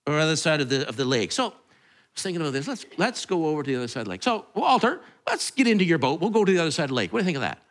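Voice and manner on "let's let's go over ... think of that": fast, offhand invitation; breezy casual. Retold or imitated. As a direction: toss it off quickly like a casual suggestion. Casual Jesus, fast, to Walter.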